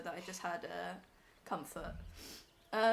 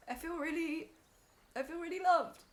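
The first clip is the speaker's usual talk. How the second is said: high voice, overcome with emotion; real tearful voice; 5 semitones higher than usual. Real, not acted. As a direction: choke up from feeling loved. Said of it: "now I'm crying on stream".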